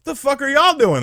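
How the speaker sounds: high, loud, disbelieving outburst; incredulous question. Retold or imitated. Same question when more kids arrive.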